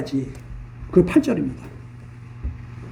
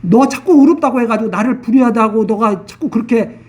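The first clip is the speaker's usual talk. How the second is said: ordinary talk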